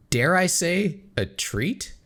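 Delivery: ordinary talk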